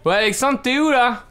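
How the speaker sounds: high, loud comic sketch voice; high silly sketch voice; character calling out. Acted. Played web series sketch, character calling.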